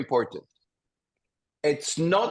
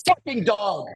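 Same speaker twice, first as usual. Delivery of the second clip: mock scream; 7 semitones higher, much slower than usual. Shown as a demonstration. Therapist acts out releasing fear at a barking dog, angry shout. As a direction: blast it out at the dog. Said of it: "And then scream".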